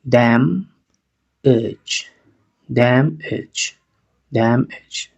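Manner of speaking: "Damage" said as dam-ech, the second a as a short e; a sounds like e. Shown as a demonstration. Teacher modelling for students, a as short e. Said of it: "Fíjate cómo suena esa A. Suena E".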